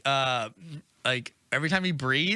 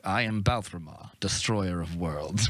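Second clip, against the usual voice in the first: low, slow, imposing dragon voice; grand dragon; 6 semitones lower than usual. Retold or imitated. Dragon introducing himself to his new master, grandly. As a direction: announce yourself like a mighty beast.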